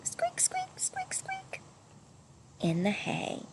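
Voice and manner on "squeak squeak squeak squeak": tiny whispered squeak, far higher and softer; mouse squeaks. A narrator's reading. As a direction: squeak like a small mouse in the hay. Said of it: "rustling and squeaking".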